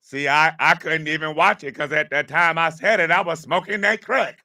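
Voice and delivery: mock excuse. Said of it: "what do y'all think he blamed it on".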